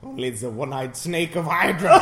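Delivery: loud laughing proclamation. Real, not acted. Laughing joke.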